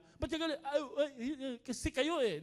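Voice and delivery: high, flustered sputter; mock tongue-tied anger, words tripping and restarting; angry stammering. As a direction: act too worked up to finish the sentence. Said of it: "Pautal-utal".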